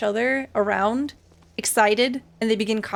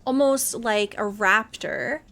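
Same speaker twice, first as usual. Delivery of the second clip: usual speaking voice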